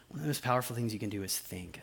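quick hushed whisper. Shown as a demonstration. Pastor repeating the key point to the congregation, soft whisper. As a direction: whisper it like a secret to lean in for. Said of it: "repeated line whispered".

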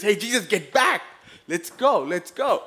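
high, loud panicked shout to flee; panicked warning. Retold or imitated. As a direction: yell at Jesus to get back and run. Disciples panicking at demon man.

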